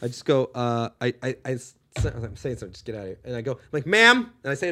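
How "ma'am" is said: pointed, louder call of "Ma'am"; sharp, high attention-getter; confronting. Retold or imitated. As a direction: call her out with one raised word. Confronting a rude customer in story.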